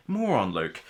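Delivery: normal talking voice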